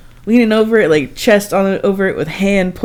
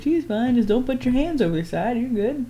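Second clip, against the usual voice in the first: higher, softer calm reassurance; reassuring guide; 7 semitones higher, 4 dB quieter than usual. Retold or imitated. Boat driver calming the husband, higher soft reassurance. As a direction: soothe the nervous husband. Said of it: "even the boat driver was just like".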